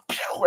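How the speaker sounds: mouth-made shooting noise; quick "pew" sound effect; imitated gunshot "pew". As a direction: imitate a shot going off. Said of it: "and he goes".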